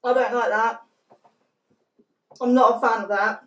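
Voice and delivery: talking while chewing food; muffled behind hand over mouth; mouth full, chewing. Real, not acted. Seen 5: Hand over mouth, grimacing, lips pressed as if chewing after eating.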